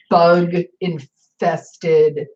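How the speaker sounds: slow, each syllable stretched out; bug-infested said very slowly and pointedly; drawn-out emphasis. Real, not acted. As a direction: linger on bug-infested with disgust. Scorn for cheap run-down housing.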